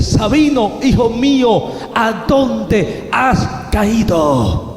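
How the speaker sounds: a divine summons to a lost son; voice from the radiance. Retold or imitated. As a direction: call out loudly, drawing out the final question. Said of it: "vuelve a hablar aquella voz".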